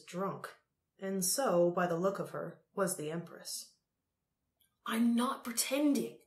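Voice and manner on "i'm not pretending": higher-pitched urgent protest. A narrator's reading. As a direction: insist with real urgency. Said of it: "he said urgently".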